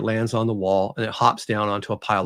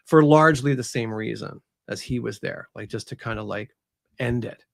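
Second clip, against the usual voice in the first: normal talking voice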